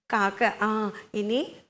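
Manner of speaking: ordinary delivery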